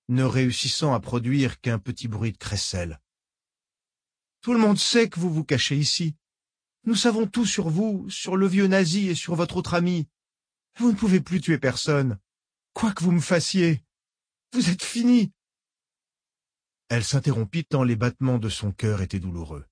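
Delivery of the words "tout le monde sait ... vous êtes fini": défi; defiant. Acted. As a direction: throw it in her face. Nathalie defies the old woman.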